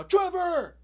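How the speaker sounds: high, loud shout of a name; sudden calling out. Acted. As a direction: yell for a lost pet, interrupting. Cry for lost toad interrupts.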